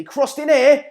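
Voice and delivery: loud, high surge of excitement; real live match excitement; excited commentary. Real, not acted. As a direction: shout the cross as it comes in. Live commentary on a dangerous cross.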